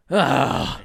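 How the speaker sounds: high, loud groan of dismay; drawn-out exasperated "oh God"; mock anguished groan. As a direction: groan like a beaten fan. Acts out crowd being choked out.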